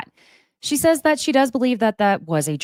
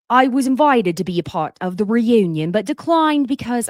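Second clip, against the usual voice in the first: put-on British accent; 5 semitones higher, 4 dB louder than usual. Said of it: "I'm not doing the British accent".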